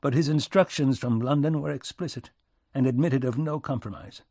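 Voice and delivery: ordinary delivery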